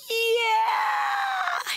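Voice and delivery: very high drawn-out wail; long wailed cry, imitated; child breaking down. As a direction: mimic a toddler bursting into tears. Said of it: "He just broke".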